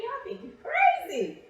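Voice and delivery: high, drawn-out mock alarm at the boy. Telling her family about the boy who stares, mock alarmed high voice. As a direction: play up how weird he seemed.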